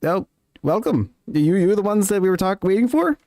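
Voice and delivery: rising, eager dwarf voice climbing high; a lightly voiced dwarf, welcoming the party; dwarf greeting. Game master voicing a dwarf greeting the party, rising eagerly. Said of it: "they kinda just go".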